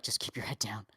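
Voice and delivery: low quiet mock advice; muttered advice. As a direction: mutter it low like a yes-man. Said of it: "a motherfucker who's like".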